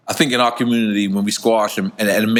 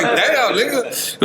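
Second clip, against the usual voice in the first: high mock exclaim; 10 semitones higher, much slower than usual. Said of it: "I was like".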